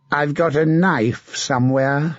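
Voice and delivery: a mad, leering knight taunting his captive; sinister, mocking menace; a twisted zealot savouring the moment; creepy villain. Sir Martin menaces Melisande.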